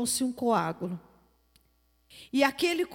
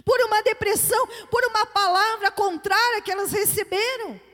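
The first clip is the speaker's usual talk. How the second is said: loud, high-pitched exhortation; fervent preaching; 9 semitones higher, 8 dB louder than usual. Real, not acted. Preacher urging the congregation to fight and pray, fervent and loud. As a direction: preach it forcefully, stretching the key phrases.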